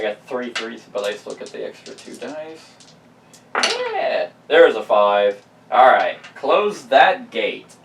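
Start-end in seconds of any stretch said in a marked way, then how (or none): 3.5-4.3 high, loud whoop; excited cheer. Real, not acted. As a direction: cheer the roll. Celebrating a good roll.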